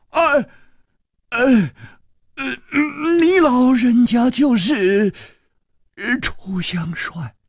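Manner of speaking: high, stammering awe, fading at the name; stunned stammer. Acted. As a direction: gasp and stumble in disbelief. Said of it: "痴痴地说". (目瞪口呆地结巴)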